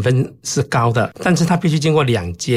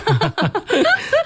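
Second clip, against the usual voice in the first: high bursting laugh at his own joke; a high, delighted burst of laughter; real laugh. Real, not acted. Joking about counting stars.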